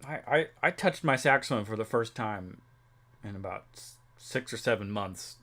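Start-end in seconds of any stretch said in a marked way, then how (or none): none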